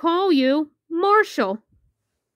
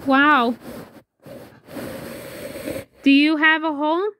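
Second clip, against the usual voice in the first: ordinary talk, nothing marked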